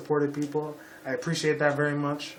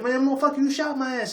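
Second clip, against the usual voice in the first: higher, put-on street voice; 10 semitones higher than usual. Vlogger mocking how the man did not talk, put-on street voice.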